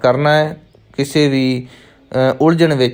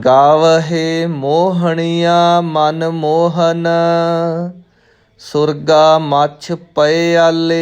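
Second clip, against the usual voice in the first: measured chant-like recital with drawn-out line endings; scripture recitation; 4 semitones higher, much slower than usual. Shown as a demonstration. Teacher reciting Gurbani for new students, measured chanted recitation. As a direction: recite slowly on a level, sustained tone.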